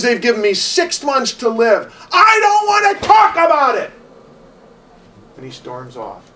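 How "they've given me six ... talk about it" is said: building to a loud, much higher yell; retold angry shout. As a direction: shout it out, then storm off. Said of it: "He says".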